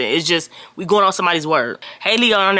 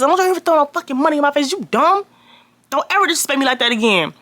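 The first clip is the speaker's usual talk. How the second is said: high, fast furious scolding; angry yell; 7 semitones higher, faster than usual. Retold or imitated. Scolding Tyrell after hitting him with a Bible, fast angry yell. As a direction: fire it off high and fast, enraged.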